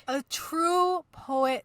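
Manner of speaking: slow and grand; slow grand delivery. Pretend. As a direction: savour each word. Mock grand introduction.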